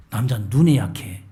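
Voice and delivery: ordinary talk, nothing marked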